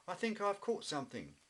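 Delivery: eager, sure he has a catch; excited. Acted. Wibbly telling Jackie about his catch, excited and high. Fish tugging on the line.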